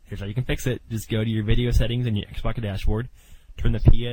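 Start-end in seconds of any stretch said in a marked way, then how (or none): 3.6-4.1 loud bursts on each P; letters spoken with hard, loud popping P's; popping p sounds. Real, not acted. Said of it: "my P's are popping".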